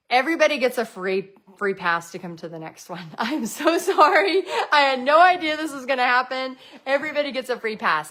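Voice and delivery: flustered apology. Retold or imitated. Host apologising to painters in the dark, flustered and laughing. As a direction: retell the panicked mid-event apology with a laugh.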